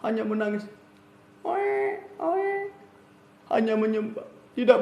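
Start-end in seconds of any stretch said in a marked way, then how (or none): 1.4-2.7 put-on high baby wail "oe, oe"; baby cry imitation. Preacher imitating a baby crying to illustrate prayer. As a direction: mimic a newborn's cry, high.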